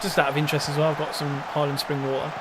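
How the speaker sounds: ordinary delivery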